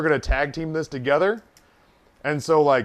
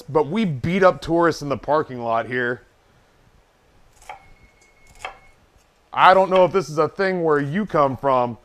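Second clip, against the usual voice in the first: livid, acted threat; 4 dB louder than usual. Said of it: "Dude, I was livid".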